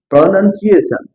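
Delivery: प्रोनाउंसिएशन with a short "nun", never "noun"; correct pronunciation. Shown as a demonstration. Teacher repeats the word for students, correct pronunciation. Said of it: "इसको पढ़ते हैं".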